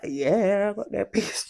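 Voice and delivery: a giggling yes to mum; cheeky admission. Retold or imitated. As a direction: own up with a giggle. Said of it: "I'm like".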